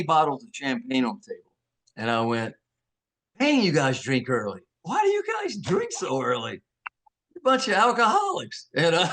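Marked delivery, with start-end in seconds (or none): 3.4-8.7 teasing mock disbelief, pitch climbing; playful accusation; retold joking jab. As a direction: needle them cheekily, rising higher as it goes. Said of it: "So I'm joking with them".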